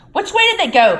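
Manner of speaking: high, loud demanding; urgent caretaker. A narrator's reading. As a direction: hiss at the poltergeist, hunting. Filch voice.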